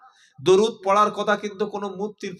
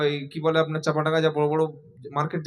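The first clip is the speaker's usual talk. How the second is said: normal talking voice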